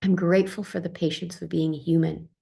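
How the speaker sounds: usual speaking voice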